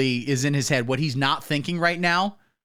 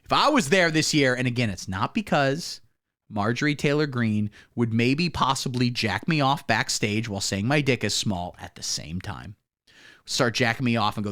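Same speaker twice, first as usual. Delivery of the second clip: usual speaking voice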